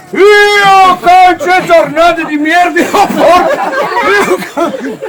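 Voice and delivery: angry shout. Pretend. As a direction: bellow the curse out. Worker's outburst in the compartment.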